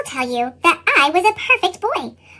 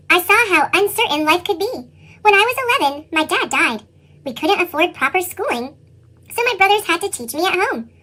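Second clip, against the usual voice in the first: ordinary delivery, nothing marked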